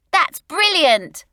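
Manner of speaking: put-on high, loud delighted boy; excited cry. A narrator's reading. Stan thrilled the door is locked, excited. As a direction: cry it out grinning. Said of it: "cried out Stan, grinning".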